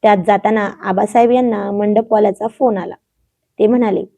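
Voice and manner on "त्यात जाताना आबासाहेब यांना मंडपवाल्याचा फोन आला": plain reading voice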